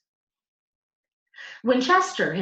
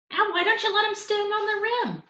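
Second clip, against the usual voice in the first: high, held-out voice for Mickey; friendly counterman. A narrator's reading. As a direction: coax him like a curious counterman. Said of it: "asked Mickey".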